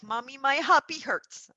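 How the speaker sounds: put-on high, loud little-kid voice; mimicking a toddler's whiny complaint; imitating her little daughter. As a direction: play the small girl complaining her hip hurts. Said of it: "She'd be like".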